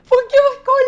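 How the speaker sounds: high burst of laughter running into the words; laughing. Real, not acted. Friend laughing as she retells the hairdresser's praise. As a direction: laugh it out, barely able to speak. Laughing at her own story.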